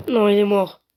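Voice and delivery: loud, high burst of dismay; dismayed cry. Real, not acted. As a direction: cry out at a loss. Friend has died. (cri de consternation)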